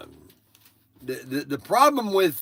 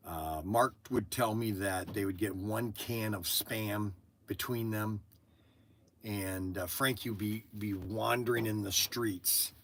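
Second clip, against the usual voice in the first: much softer, lower and subdued; telling of son's abuse quietly; sombre; 7 semitones lower, 13 dB quieter than usual. Real, not acted. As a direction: lower the voice for a painful story. Sad backstory of son's abuse.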